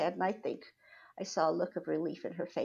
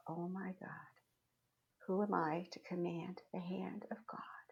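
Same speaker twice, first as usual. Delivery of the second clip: near-whispered humbled question; hushed awe; 2 semitones lower, 7 dB quieter than usual. Retold or imitated. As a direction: say it under your breath, awed at yourself. Retold thought, humbled awe.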